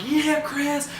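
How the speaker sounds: high mock cheer without real volume; imitated crowd cheer. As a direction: cheer like the crowd around a winner.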